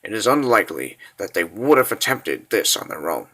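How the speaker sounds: low, subdued military report; grave briefing. Acted. Officer briefing his lord on the kidnapping, grave and low. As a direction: report it softly and low, measured.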